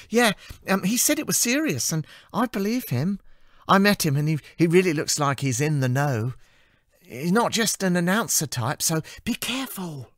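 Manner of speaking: bubbly and earnest with a warning; cheerful assistant. Acted. Amy warning Anna about the curfew, in a high cheerful voice.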